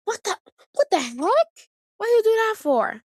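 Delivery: high, indignant "what the heck"; a high mock-outraged cry; outraged reply. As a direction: protest sharply at a little brother. Outraged at brother flicking food.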